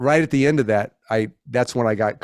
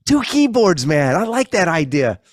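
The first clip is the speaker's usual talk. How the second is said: imitating Billy; 11 semitones higher, 3 dB louder, slower than usual. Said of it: "I could see him like".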